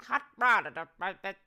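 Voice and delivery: mock old Hollywood accent; fifties movie accent. Trading movie parody lines, fifties movie accent. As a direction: imitate a fifties film character's accent. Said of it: "they all talk like this".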